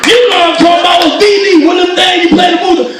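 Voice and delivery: fast, high, loud impatient explanation; yelling. Retold or imitated. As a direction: rattle it off loudly down the phone. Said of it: "Now he yelling".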